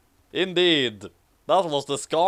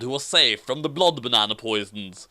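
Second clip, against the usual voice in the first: ordinary talk, nothing marked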